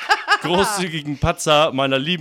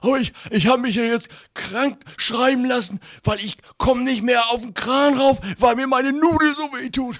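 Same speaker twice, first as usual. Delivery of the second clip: high put-on voice; squeaky comic character; 7 semitones higher than usual.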